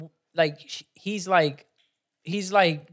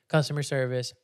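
usual speaking voice

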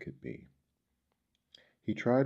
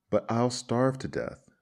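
higher, louder narrated wail; understated weeping, raised in protest; tearful plea; 6 semitones higher, 5 dB louder than usual. A narrator's reading. Refused grasshopper begs the ant, mildly tearful. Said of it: "wept the grasshopper".

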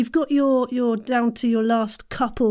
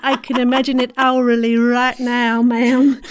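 put-on Southern Belle accent; 3 semitones higher, 5 dB louder than usual. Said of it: "that Southern Belle accent".

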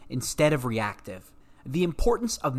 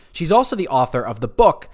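usual speaking voice

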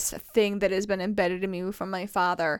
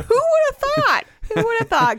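high, loud laughing sarcasm; shrieking with laughter at her own quirk; laughing self-mockery; 14 semitones higher, 7 dB louder than usual. Real, not acted. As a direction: crack up while mocking yourself.

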